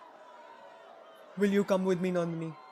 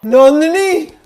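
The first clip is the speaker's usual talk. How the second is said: much louder, higher call of her name; anguished cry; 7 semitones higher, 10 dB louder, slower than usual. Acted. Raja crying after Nandini, loud anguished call.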